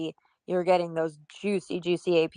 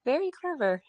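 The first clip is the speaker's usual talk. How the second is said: wry amused; 7 semitones higher than usual. Retold or imitated. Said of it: "I laughed to myself and said".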